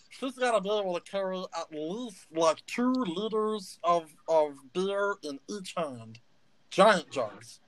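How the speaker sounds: a little gnome spiralling into a crisis; panicky gnome Stitch. Acted. Gnome Stitch freaks out that a stranger guessed his name. Stitch talks with tavern patrons.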